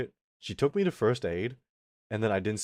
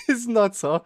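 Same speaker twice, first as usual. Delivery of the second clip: laughing; 11 semitones higher, 6 dB louder than usual. Real, not acted. Host laughing at the story.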